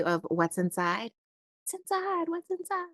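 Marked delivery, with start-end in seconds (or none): 1.6-2.9 much higher, playful echo of the heading. Pretend. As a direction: echo the words in a high playful voice. Playful echo of card title.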